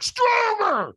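very high, loud scream; screamed command. Retold or imitated. Trainer screaming right in the firewalker's face, fierce demand. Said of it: "And he screams at me".

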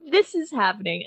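usual speaking voice

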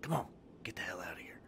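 fast, soft, low whisper; under-breath mumble. Acted. Game master repeats what was overheard in the cellar, whispered mumble. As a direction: mutter it quickly under your breath. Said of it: "as I recall, I said".

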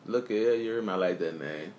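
ordinary talk, nothing marked

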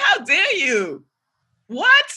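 high, loud, slow indignant how dare you; mock outrage. Retelling a text fight to her friend, mock indignant. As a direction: play up the offended outrage.

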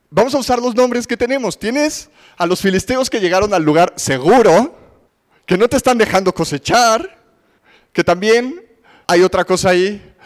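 ordinary talk

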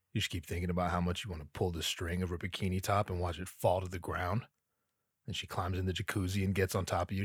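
low, soft and quick, almost confiding; hushed fantasy. Acted. Comic describing the jacuzzi fantasy, low hushed murmur. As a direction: murmur the daydream fast.

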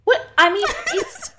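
breaking into laughter; laughing. Real, not acted. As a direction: laugh through the words. Laughs at friend's reaction.

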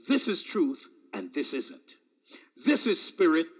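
ordinary talk, nothing marked

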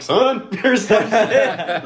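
loud, high, drawn-out comic call; put-on character voice. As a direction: break into the bit voice, laughing. Acts out walking up in character.

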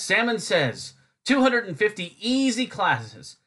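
high, slow hard-sell announcer; mock commercial pitch with drawn-out key words; parody ad read. Parody language-class advertisement.

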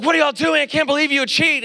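high-pitched retold professor's outrage; appalled scolding. Professor catching students cheating on a test, appalled scolding.

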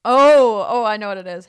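loud burst of recognition; sudden realization. Real, not acted. Friend suddenly remembers the guy, loud excited realization. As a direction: blurt it out as it clicks.

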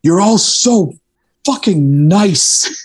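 high, slow, drawn-out mock Dutch accent; impersonating a Dutch tour manager, high and stretched out. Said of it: "the Dutch tour manager turns around and he's like".

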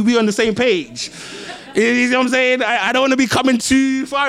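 loud, high, driving preacher voice; full-force preaching. Acted. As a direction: preach it out to the whole room.

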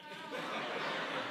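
a high, unvoiced laugh; a pained breathy laugh. Real, not acted. Laughs at painful memory.

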